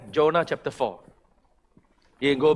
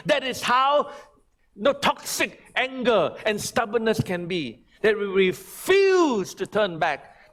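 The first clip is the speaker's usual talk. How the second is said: forceful preaching; 8 semitones higher than usual. Acted. Pastor warning the church against stubborn anger, forceful preaching. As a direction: preach it hard, a stern warning.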